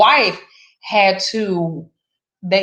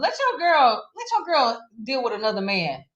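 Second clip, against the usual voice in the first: indignant; 6 semitones higher than usual. Real, not acted. Outraged hypothetical.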